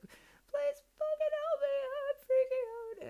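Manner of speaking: high, loud crying wail, slowed right down; tearful desperate plea. Retold or imitated. Driving alone in tears, begging the universe for help, high sobbing wail. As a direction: sob it out, begging for help while falling apart. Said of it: "said it in tears".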